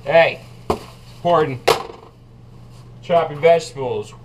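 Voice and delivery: usual speaking voice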